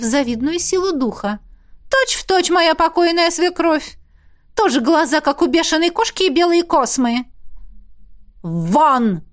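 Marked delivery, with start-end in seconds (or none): 1.9-7.3 high, scornful jeer; mocking, pitched well up; scornful taunt. A narrator's reading. Woman mocks rector during quarrel. 8.4-9.2 contained yell of dismissal; angry but restrained yell; yelled order. A narrator's reading. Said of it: "заорал Изергаст".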